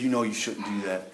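a quiet fatherly warning; a soft parental check; gentle rebuke. Retold or imitated. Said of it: "he comes by and says".

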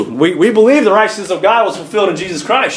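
normal talking voice

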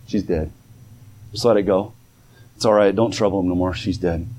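low, hushed, heavy delivery; somber news. Retold or imitated. Messengers telling Jairus his daughter died, low and somber. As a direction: break the bad news gently.

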